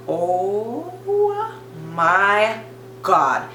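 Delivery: long, drawn-out horror, word by word; appalled realization. Retold or imitated. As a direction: mimic a friend slowly backing away aghast. Friend backs away, appalled.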